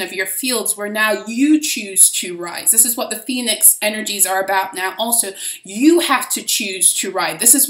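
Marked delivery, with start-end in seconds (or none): none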